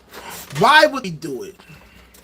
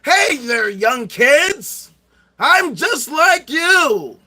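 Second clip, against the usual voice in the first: higher, slow, hammed-up cool-kid voice; mock hip youngster; 6 semitones higher, 4 dB louder, much slower than usual. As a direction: play the try-hard old guy pretending to be young. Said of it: "staying hip with the young whippersnappers".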